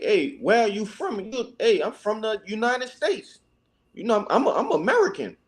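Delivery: normal talking voice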